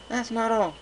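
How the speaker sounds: high little-sister voice, coaxing; a small filly asking big sis a favour; young filly. A narrator's reading. Filly cuts in.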